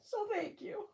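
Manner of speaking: soft, high, choked-up thank you; tearful thanks. Real, not acted. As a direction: thank them through tears, barely voiced. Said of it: "I'm not really crying now. I'm just sniffling now".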